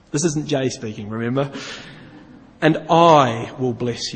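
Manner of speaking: every I will stressed; reading the promises with I punched. Shown as a demonstration. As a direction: lean hard on the word I in I will. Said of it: "I'll put emphasis on it".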